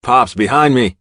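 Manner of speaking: loud protective call; yelling. A narrator's reading. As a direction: shout to shield him. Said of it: "I yell".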